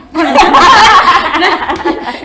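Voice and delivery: a big real burst of laughter; loud laugh. Real, not acted. Laughing out loud at her own story. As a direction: laugh out loud, high and free.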